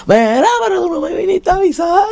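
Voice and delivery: high whiny reproach with a rising end; mock complaint. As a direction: imitate the boy complaining he wasn't told. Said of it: "Y yo era bien calle". (reproche imitado, agudo, con final en subida)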